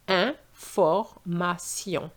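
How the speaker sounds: information slowly, broken into syllables, an-for-ma-syon; slow, spelled-out French information. Shown as a demonstration. Teacher breaks the word into parts, very slow. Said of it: "we have this sound sion".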